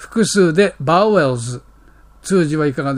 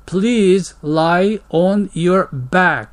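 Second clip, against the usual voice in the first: very slow, careful English phrase; slow model reading; much slower than usual. Shown as a demonstration. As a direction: say each word slowly for learners. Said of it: "slow demo of phrase". (とてもゆっくりの模範読み)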